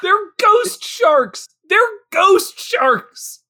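high, stretched-out incredulous protest; exasperated. Real, not acted. Co-host ranting about ghost sharks, exasperated. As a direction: drag each word out in high exasperation.

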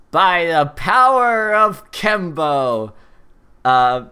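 slow, high, booming hero call; superhero rallying cry. Acted. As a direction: shout it like a cartoon superhero transformation.

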